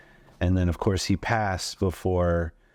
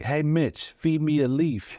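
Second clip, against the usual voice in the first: Mitch; 8 semitones higher than usual. Acted. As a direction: talk like a stuffed toy imitating a comedian, pitched up. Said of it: "Mitch's koala jokes as Mitch".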